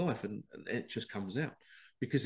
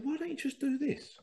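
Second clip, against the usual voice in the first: much higher, wide-eyed suggestion; naive young self. Retold or imitated. Young lad suggests a fix to coworkers, high and innocent. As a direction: pipe up like an eager youngster.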